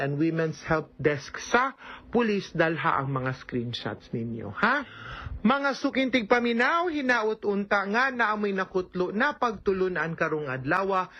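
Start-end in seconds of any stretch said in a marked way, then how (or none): 5.4-11.1 bright radio host closing the show; radio sign-off. Acted. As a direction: warm announcer farewell to listeners.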